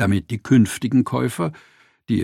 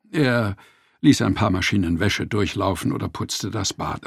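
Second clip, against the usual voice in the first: ordinary delivery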